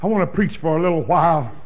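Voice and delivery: usual speaking voice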